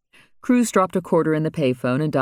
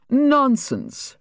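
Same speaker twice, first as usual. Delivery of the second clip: sharp high dismissal; high scoffing retort; 11 semitones higher than usual. A narrator's reading. Trader retorting.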